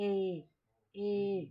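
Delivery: ए stretched, one at a time; long A demo. Shown as a demonstration. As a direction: hold ए as a drawn-out "ay". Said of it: "तो जो खिंचता है". (ए को लंबा खींचकर बोलें)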